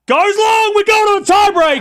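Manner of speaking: high, loud excited call of the tiebreak; excited shout. Real, not acted. Streamer calling the tiebreak to chat, high and loud. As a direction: shout it out as the set goes to a tiebreak.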